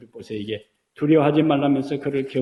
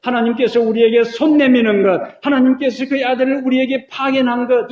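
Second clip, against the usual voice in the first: rising, louder preacher's build; preaching; 6 semitones higher, 5 dB louder than usual. Acted. Pastor to the congregation, fervent preaching. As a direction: preach it up to the climax.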